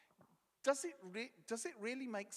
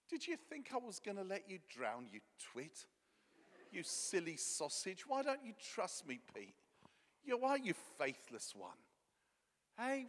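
gentle teasing. Retold or imitated. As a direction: tease him kindly like a mate. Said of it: "I think it was more like that".

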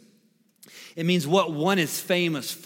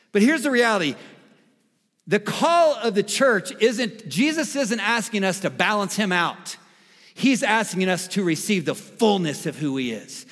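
raised, forceful sermon voice; emphatic preaching; 6 semitones higher, 5 dB louder than usual. Real, not acted. Pastor correcting the church on balancing Jesus, emphatic preaching. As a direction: preach it higher and with more push.

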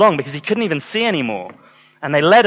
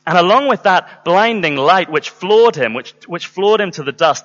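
ordinary talk, nothing marked

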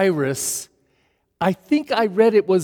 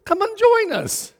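eager invitation. Retold or imitated. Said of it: "And he says".

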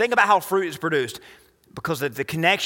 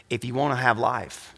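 ordinary talk, nothing marked

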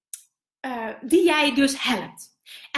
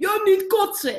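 higher, louder cheeky call from the floor; mock heckle called out; 7 semitones higher, 4 dB louder than usual. Young man on the floor heckling the speaker, calling out high and loud. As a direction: toss it out like a young heckler in the crowd. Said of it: "hij riep".

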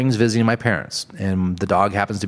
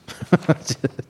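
breathy laugh; 5 semitones higher than usual. Real, not acted. Laugh after joke.

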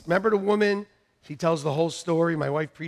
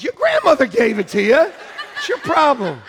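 loud, high comic retort; mock indignant parent; 8 semitones higher, 8 dB louder than usual. Parent turning down a kid who asked for fifty dollars, comically indignant. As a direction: throw it back at the child, raised voice.

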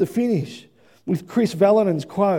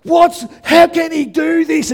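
excited commentator; 6 semitones higher, 5 dB louder than usual. Retold or imitated. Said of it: "the commentators get on board and they go".

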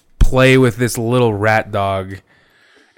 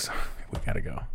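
quick unvoiced sorry, we gotta go; fast whisper; 6 semitones lower, 13 dB quieter, much faster than usual. Brushing off the son, quick whisper. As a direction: rush it under your breath. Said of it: "I'm like".

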